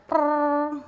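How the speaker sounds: a sighing exhale buzzed through the lips like a trumpet. Retold or imitated. Mom's exhale at the end of a long day: trumpet-like sigh. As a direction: blow a weary sigh out like a little trumpet. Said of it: "a cross between a sigh and a trumpet playing".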